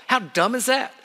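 usual speaking voice

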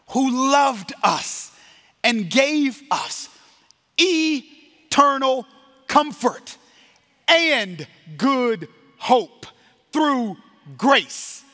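very slow, high, ringing preacher's cadence; solemn benediction. Real, not acted. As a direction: proclaim it word by word, with long pauses.

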